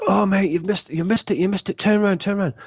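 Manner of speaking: high, urgent calls of a missed turn; mock yelling to turn the van round; retold shout. Passenger shouting at the driver who missed the hotel, high and urgent. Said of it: "and he shouts".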